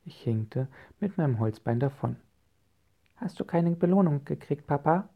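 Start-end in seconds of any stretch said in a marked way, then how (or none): 3.2-5.1 put-on high-pitched child asking a question; little child's high voice; Fuchsia. A narrator's reading. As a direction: ask Papa as a curious child. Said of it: "fragte Fuchsia".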